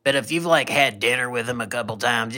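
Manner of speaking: ordinary talk, nothing marked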